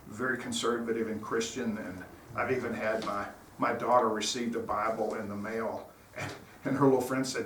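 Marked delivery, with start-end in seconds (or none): none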